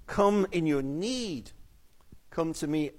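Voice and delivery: ordinary delivery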